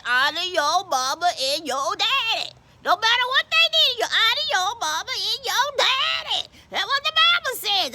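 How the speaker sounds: high, emphatic admonishing; preachy insistence. Acted. Deacon quoting the Bible about Coco, sternly insistent.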